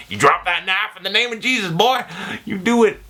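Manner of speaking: high mock preacher voice; hammed-up rebuke of the mugger; church lady. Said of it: "quoting the scene again".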